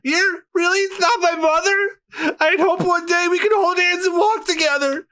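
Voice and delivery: high sobbing cry; crying shout. Acted. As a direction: wail it through tears. Robin crying out.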